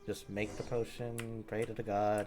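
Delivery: ordinary delivery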